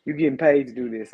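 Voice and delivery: ordinary talk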